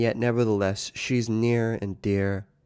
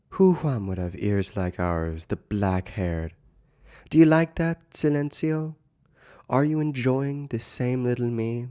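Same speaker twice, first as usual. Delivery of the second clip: higher, lilting voice for coaxing questions; dramatic literary reading; 4 semitones higher than usual. Acted. Lecturer reads Joyce's passage on silence to listeners, dramatic reading. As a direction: act out the intimate questions to a lover, pitch raised, easing down at the endearments.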